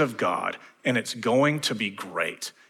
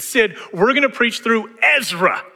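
eager announcement; 11 semitones higher, 6 dB louder than usual. Retold or imitated. Pastor voicing his own eager reply.

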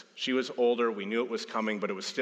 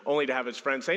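normal talking voice

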